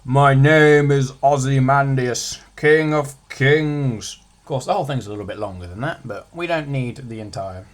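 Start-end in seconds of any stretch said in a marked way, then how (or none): 0.0-4.2 slow, loud regal proclamation; grand king's declaration. Shown as a demonstration. Teacher reads the king's boast as a slow loud proclamation. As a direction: declaim it like a mighty king. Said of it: "very classic, famous one".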